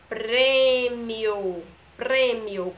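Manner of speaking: PRÊMIO loud and drawn out, with a closed "ei"-like first vowel; closed Ê sound. Shown as a demonstration. Teacher introducing the circumflex word, PRÊMIO loud with long closed Ê. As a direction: lean hard on the closed Ê in PRÊMIO. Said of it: "o som do E está mais fechado".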